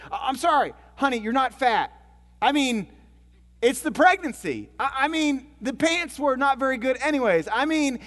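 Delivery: high, loud, stammering backpedal; mock frantic apology. Husband trying to amend it with crying wife, mock panic. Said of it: "he tries to amend the situation".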